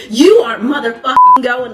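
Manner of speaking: loud angry snap; angry mom. Retold or imitated. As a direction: lay down the law loudly. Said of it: "Megan was like".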